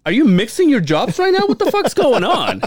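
loud, high disbelief; incredulous. Retold or imitated. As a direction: call him out in disbelief. Incredulous retort to cashier.

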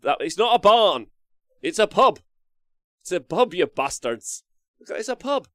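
loud mock outrage correcting everyone; mock indignant. Real, not acted. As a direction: playfully scold the chat. Insisting to chat, mock indignant.

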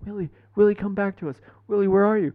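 high pleading call; lightly voiced high pleading to the dog. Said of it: "We're like".

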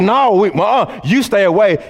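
hammed-up nervous brush-off; scared refusal. Jerusalem believers fearfully waving Saul away, mock alarm. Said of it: "were scared of him".

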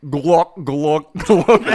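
loud gulping glok noises; gagging sound. Shown as a demonstration. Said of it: "that's the sound they make".

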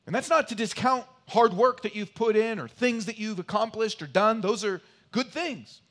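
loud, high, driving sermon voice; forceful preaching. Acted. Preacher at full force, opening.

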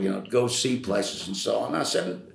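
usual speaking voice

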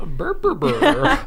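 high, slow creature babble; Grimace voice. Acted. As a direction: burble it like a cartoon monster. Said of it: "Grimace gibberish again".

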